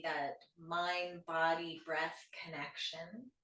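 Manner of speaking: soft, unhurried yoga nidra guidance; guided meditation. Shown as a demonstration. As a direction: ease listeners into stillness, with long pauses between phrases. Leads guided breathing meditation.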